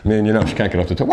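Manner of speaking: ordinary delivery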